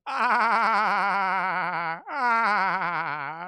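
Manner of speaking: loud thrilled cries on the ride; thrilled yells. Real, not acted. Riding the coaster, thrilled shouting. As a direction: yell through the drop.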